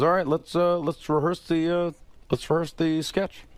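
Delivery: imitating Obama. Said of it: "He goes".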